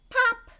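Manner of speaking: a high loud pop; high bright pop; popping sound. Shown as a demonstration. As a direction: pop it out like a bubble. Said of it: "When you come to a period, say".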